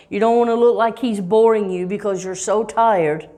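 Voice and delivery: ordinary delivery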